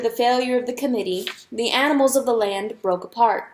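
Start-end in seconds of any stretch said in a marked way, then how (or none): none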